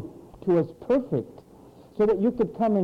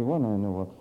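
mock exasperation; 11 semitones lower, 4 dB quieter, faster than usual. Preacher's own reply quoted.

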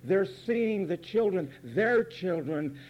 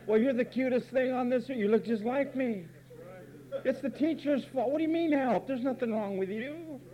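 doting parent; 6 semitones higher than usual. Retold or imitated. Parents voiced doting on child.

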